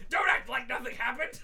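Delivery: high, loud yell through laughter; mock outraged shout. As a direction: burst out like a cartoon villain. Acts bacterium bursting through wall.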